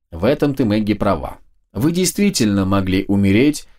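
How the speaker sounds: usual speaking voice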